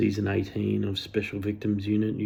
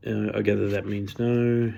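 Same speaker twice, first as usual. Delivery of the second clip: usual speaking voice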